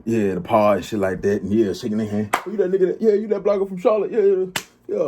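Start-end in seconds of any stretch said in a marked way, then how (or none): 2.5-5.0 high, eager fan greeting; mock excited fan voice. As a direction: play the starstruck fan who recognizes him.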